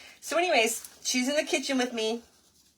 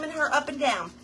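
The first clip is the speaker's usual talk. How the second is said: usual speaking voice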